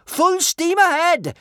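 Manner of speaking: slow, high, full-voiced shout. A narrator's reading. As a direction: bellow the order across the boat. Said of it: "shouted Kamikaze".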